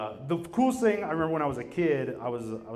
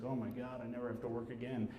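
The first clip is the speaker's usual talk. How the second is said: put-on much softer, relieved old lady; soft relieved sigh; 3 semitones lower, 12 dB quieter than usual. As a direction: breathe it out, relieved and quiet. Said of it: "She's like".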